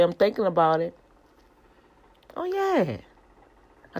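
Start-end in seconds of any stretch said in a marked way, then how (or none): 2.4-3.0 a giddy falsetto oh yeah; high squeal. Acted. Repeats exclamation, second time squealed.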